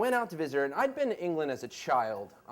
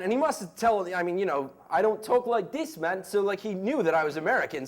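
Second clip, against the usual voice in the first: ordinary delivery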